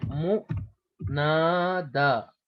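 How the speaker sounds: मुनादा drawn out very slowly, syllable by syllable. Shown as a demonstration. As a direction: sound out मुनादा in long, separate syllables. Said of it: "उसको मुनादा कहते हैं". (मुनादा को बहुत धीरे, हर हिस्सा अलग करके)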